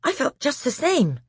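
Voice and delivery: put-on high breathless boy; breathless frightened boy. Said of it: "in a breathless voice".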